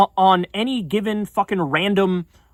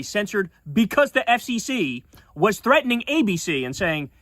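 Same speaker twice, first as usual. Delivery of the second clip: ordinary delivery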